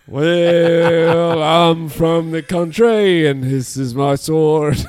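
mock Texan accent, slow and loud; Texan drawl. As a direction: ham up a Texan as done by an Irishman. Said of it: "do an impression of a Texan for us".

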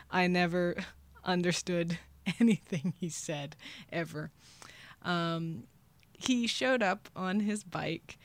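ordinary delivery, nothing marked

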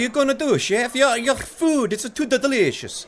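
high, loud Italian waiter; Italian accent. Acted. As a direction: plead in thick Italian. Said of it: "both boys speak in thick Italian accents".

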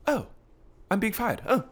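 high, soft posh British voice, a bit breathy at the end; posh British, chirping politely about being fired; upper-class British accent, accepting being fired sweetly. Retold or imitated. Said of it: "Like the high class Brits".

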